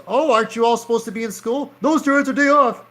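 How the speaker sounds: high, pushy cop voice; high officer voice. Acted. Officer and kid exchange.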